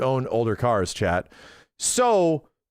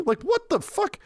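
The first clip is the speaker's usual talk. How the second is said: much higher, incredulous outburst; squeaky stunned what; amazed disbelief; 14 semitones higher than usual. Real, not acted. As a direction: react in wide-eyed disbelief. Hearing Toyota remakes the GT3.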